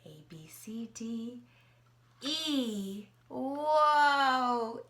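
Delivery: ordinary delivery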